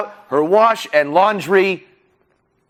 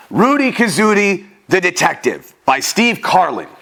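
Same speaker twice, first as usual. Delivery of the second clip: usual speaking voice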